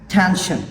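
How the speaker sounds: "tension" with a puff of air on the T; aspirated T. Shown as a demonstration. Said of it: "We should aspirate this sound".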